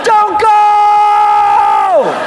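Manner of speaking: high, drawn-out pleading scream; desperate fan. Acted. Banned fan screaming after the escaping band, desperate wail. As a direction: wail after them at the top of your voice.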